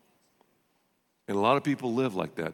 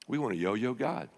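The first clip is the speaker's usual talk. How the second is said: normal talking voice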